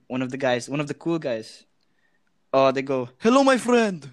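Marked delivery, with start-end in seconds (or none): 3.2-4.1 put-on higher, loud Arabic-accented greeting. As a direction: imitate a hearty gamer with an Arabic accent. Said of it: "Yeah, some Arabic, some Arabic, like, accent".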